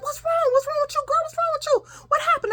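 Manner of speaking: very high, urgent questioning; acted alarmed father. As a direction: fire off panicked questions like a worried parent. Woken father alarmed at screaming.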